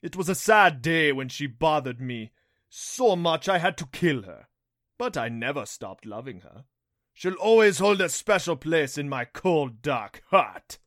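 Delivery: loud, theatrical mock sentiment; gloating Rompo beast. A narrator's reading. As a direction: reminisce loudly with villainous relish.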